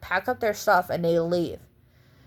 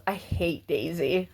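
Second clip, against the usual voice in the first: quiet quote; 2 semitones lower, 7 dB quieter, slower than usual. Retold or imitated. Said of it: "and goes".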